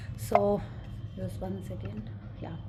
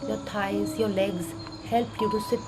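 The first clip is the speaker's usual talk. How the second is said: soft, slow meditation voice; calmly walk them through gratitude; guided meditation; 4 dB quieter, much slower than usual. Acted.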